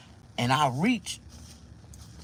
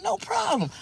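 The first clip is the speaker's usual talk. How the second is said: cocky high brag. Retold or imitated. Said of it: "I'm like".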